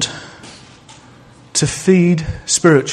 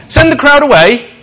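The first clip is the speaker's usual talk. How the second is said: disciples' plea; 10 semitones higher, 7 dB louder than usual. Retold or imitated. Disciples urge Jesus.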